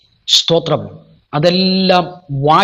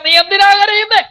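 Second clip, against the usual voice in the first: high, climbing preacher's cry; God challenging the weeper; 13 semitones higher, 3 dB louder than usual. Acted. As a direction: build to a high, ringing question. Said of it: "നിന്നോട് കർത്താവ് പറയുക".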